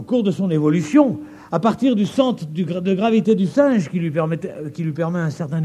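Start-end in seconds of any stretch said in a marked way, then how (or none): none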